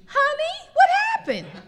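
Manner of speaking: high, laughing plea; helpless wife on phone. Retold or imitated. Wife calls husband lost, high laughing plea. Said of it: "calling Andre saying".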